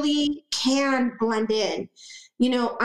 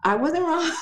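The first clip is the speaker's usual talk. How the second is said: a smug little laugh through the words; 5 semitones higher than usual. Real, not acted. Wife teasing husband she was right, laughing. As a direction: gloat playfully at her husband. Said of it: "Was I wrong, babe?".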